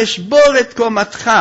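loud, high outburst of menace; acted threat. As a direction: hurl the threat at him. Woman threatens to break him.

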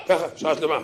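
normal talking voice

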